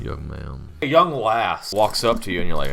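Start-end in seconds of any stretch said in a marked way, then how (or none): 0.0-0.7 low, soft put-on address; deepened voice. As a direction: address a stranger in a deep voice. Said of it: "I make my voice deeper".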